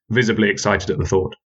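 plain reading voice

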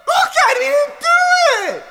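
high, hammed-up crying shout; mock tearful wail. Defense lawyer begging the judge, hammed-up tearful wail. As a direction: wail it like a pleading lawyer about to cry.

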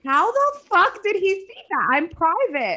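high-pitched, disbelieving outburst; retold outrage, pitched high; incredulous outburst. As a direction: replay your shock, voice shooting way up. Retells shock at being seen.

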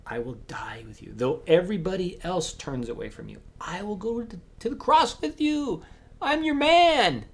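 rising boast. Acted. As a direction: swell with bold loyalty to the end. Peter boasting loyalty.

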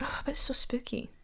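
hushed spooky whisper. Real, not acted. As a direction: breathe it out in a whisper. Reacting to spooky horror films.

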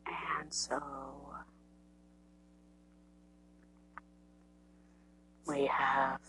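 soft, hushed murmur; whispering. Real, not acted. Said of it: "I have to whisper".

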